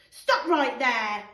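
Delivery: slow loud bossy command; commanding stop. Acted. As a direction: halt them like a guard. George halting the others.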